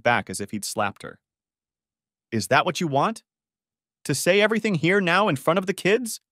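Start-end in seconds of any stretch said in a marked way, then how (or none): 2.3-6.2 tight, angry rising challenge; hissed. A narrator's reading. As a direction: push the question at him, raised. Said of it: "she hissed".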